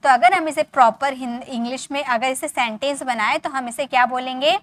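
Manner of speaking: usual speaking voice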